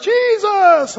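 high, loud Sunday school shout; imitating a kid, piercingly high; little kid answering. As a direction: yell the answer like an eager child. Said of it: "you're a little kid and they ask".

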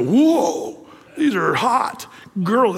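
playfully imitating love-struck lads; smitten boys. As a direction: drag it out slowly, mock-ogling.

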